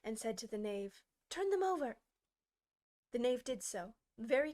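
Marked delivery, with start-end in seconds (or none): 1.3-2.0 high, sharp command; angry order. Acted. Queen ordering the knave, angry command. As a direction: bark it at the knave. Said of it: "The queen turned angrily away".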